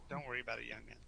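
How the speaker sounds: same words dropped to a soft murmur; gentle fade, almost to himself. Acted.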